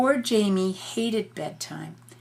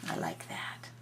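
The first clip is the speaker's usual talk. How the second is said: breathy whispered aside; 13 dB quieter than usual. Real, not acted. Parent whispering to child over the picture, soft whisper. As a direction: drop to a hush. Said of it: "whispered aside".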